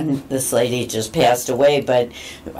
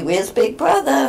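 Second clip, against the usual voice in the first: pet talk; 9 semitones higher than usual. Retold or imitated. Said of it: "if I say to Joey".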